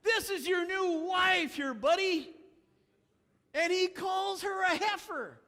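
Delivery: high, loud scolding shout; indignant shout. Real, not acted. Preacher shouting at Samson for calling his wife a heifer. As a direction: call him out loudly.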